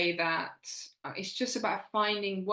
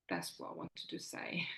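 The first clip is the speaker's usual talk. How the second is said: soft whispered sign-off; 13 semitones lower, 9 dB quieter than usual. Real, not acted. Guest closing her advice to women, whispered. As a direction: drop to a breathy whisper to finish.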